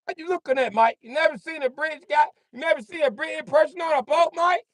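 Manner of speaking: high put-on English voice; hammed-up Brit on a boat; mock British accent.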